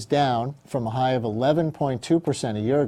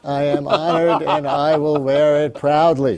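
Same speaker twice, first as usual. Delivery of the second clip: mock solemn pledge; 2 semitones higher, 7 dB louder than usual. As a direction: accept the cap like a knighthood, hammed-up. Host accepts gift cap, mock solemn.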